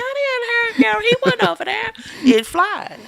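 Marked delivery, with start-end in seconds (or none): 0.0-1.9 much higher, laughing fake innocence; high laughing mock denial. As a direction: play innocent, high and giggly.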